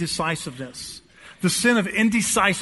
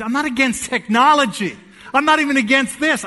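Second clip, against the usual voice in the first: fiery preaching; 5 semitones higher, 6 dB louder than usual. Real, not acted. Preacher rebuking phone addiction, full force. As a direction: pound it home to the pews.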